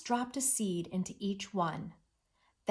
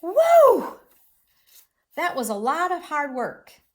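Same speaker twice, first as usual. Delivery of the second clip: loud high woo, then emphatic; exclaiming; 8 semitones higher, 11 dB louder than usual. Shown as a demonstration. Mole cheers after the garden work, exclaiming. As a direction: shout it with relief. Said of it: "when Mole said".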